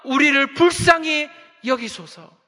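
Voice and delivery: loud, slow desperate plea. Retold or imitated. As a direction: cry out, drawing out each word. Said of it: "더 큰 소리로 예수님께 부르짖기 시작했습니다".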